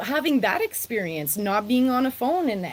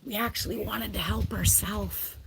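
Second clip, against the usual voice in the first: confiding whisper, softer; 6 dB quieter than usual. Real, not acted. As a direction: whisper it as a confession. Confessing a truth about social workers.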